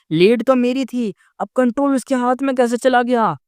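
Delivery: put-on a frustrated girl's disbelieving complaint. A narrator's reading. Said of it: "एकदम frustrated हो गई". (निराश और झुंझलाई हुई ऊँची आवाज़)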